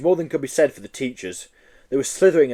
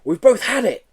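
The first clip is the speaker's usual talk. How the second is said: panicked; 10 semitones higher, 5 dB louder than usual. Acted. Joe panics.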